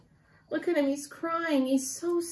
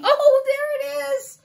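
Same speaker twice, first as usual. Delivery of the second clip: excited; 12 semitones higher, 5 dB louder than usual. A narrator's reading. Baby bird reveal.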